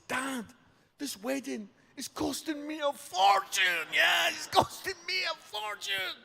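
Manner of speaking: high-pitched wail of complaint; exasperated protest. Acted. Son defending his tears to dad over wedding costs, exasperated. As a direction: plead your case, pitched right up.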